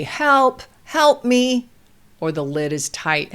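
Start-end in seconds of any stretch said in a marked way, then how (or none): 0.0-1.7 lightly voiced child calling, higher; a higher, simple call for help; child asking for help. Toddler with a stuck block, lightly voiced request for help. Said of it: "he can say".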